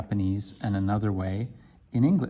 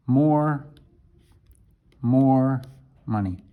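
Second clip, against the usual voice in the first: mild demanding learner; 2 semitones higher, slower than usual. Shown as a demonstration. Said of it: "it's almost like demand".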